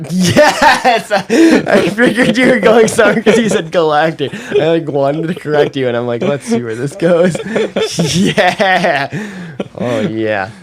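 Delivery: high, loud words through laughter; laughing hard. Real, not acted. Friend delighted by the galactic joke, laughing hard.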